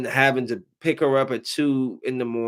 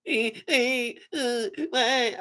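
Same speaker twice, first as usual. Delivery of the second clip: mock whimpering; 11 semitones higher, 3 dB quieter, much slower than usual. Imitating whining little boys.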